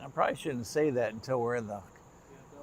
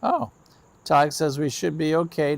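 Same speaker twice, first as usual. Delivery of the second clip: normal talking voice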